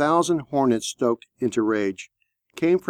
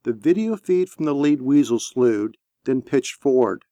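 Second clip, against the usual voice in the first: plain reading voice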